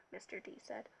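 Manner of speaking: normal talking voice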